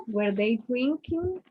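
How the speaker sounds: "Were they drinking?" said slowly, rising on "drinking"; slow question tune. Shown as a demonstration. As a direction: model the yes-no question slowly with a lift on "drinking".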